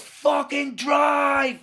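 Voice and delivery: high, loud, long-drawn roar; bellowed order. Retold or imitated. Intruder repeating his order to a frozen driver, roaring. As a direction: roar it and stretch the last word.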